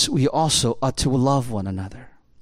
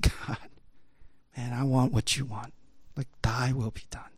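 hushed prayer; 2 semitones lower, 8 dB quieter than usual. Acted. Jesus's quiet prayer of surrender.

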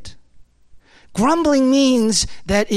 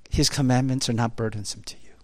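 low, soft, quickened near-whisper; hushed reassurance; 8 semitones lower, 6 dB quieter, faster than usual. Real, not acted. Preacher answers how you know you're a disciple, hushed. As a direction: drop right down and say it gently.

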